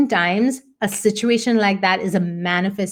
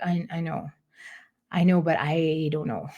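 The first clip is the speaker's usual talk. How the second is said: hesitant; 4 semitones lower, 6 dB quieter than usual. Retold or imitated. Retelling her own unsure reply.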